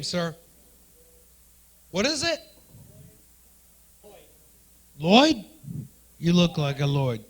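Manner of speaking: normal talking voice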